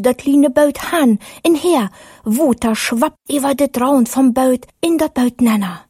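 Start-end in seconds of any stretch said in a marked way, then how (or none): none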